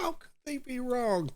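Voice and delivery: high, squeaky mocking voice; mocking repeat. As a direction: parrot the narrator with a laugh. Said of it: "Good one".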